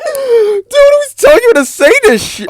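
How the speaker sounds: very high, loud disbelieving outburst; shocked squeal. Real, not acted.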